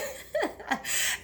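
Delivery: high unvoiced giggle; airy silent laugh; breathless laugh. Real, not acted. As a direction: laugh at the memory. Laughing at own struggle.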